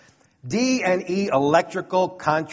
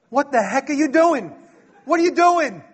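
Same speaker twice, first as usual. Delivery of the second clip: much higher and louder, a baffled boss shouting; incredulous yell; 7 semitones higher, 4 dB louder than usual. Retold or imitated. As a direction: shout it like you can't believe your eyes. Foreman catches his mistake.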